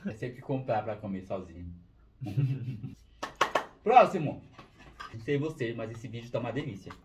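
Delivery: chewing a snack while talking; speaking between bites; mouth partly full. Real, not acted.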